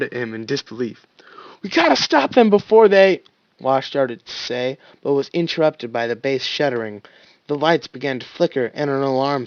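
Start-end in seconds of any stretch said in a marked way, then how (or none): 1.6-3.2 urgent shout. Acted.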